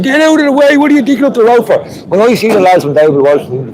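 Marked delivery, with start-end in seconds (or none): 0.0-1.8 mock angry shout. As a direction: yell at the roadworks crew in jest. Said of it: "I'd normally be shouting at these lads saying".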